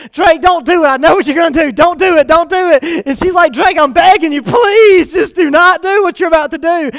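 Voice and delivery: loud, high frantic begging; imitating a wife begging her husband to stop; retold pleading. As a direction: plead with him not to jump. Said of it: "She's saying".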